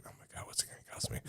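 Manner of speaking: a rushed, fast, breathy aside of dread; dread whisper. Retold or imitated. Recruit dreading the sergeant's punishment, fast whisper. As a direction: rattle it off under the breath, fast and afraid.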